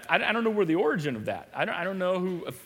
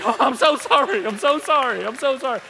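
mock apology; 6 semitones higher, 7 dB louder than usual.

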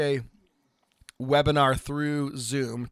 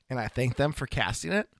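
usual speaking voice

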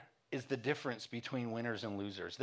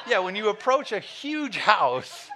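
slow, louder, laughing through it; 10 semitones higher, 10 dB louder, slower than usual. Real, not acted. Pastor laughs at his wife's mailbox excuse, laughing. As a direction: laugh out loud while saying it.

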